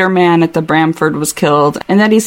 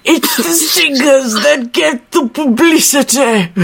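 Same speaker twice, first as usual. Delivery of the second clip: slow, higher British character accent; exaggerated British accent, pitched up; Hutch accent; 6 semitones higher than usual. Shown as a demonstration. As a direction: draw it out in an English accent. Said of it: "That's the accent I want for Hutch".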